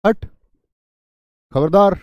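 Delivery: ज़ोर से, ऊँचे स्वर में "खबरदार"; किसी को सामान छूने से रोकते हुए; चेतावनी. Shown as a demonstration. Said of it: "ये चेतावनी बोधक होते हैं". (कड़क चेतावनी ज़ोर से)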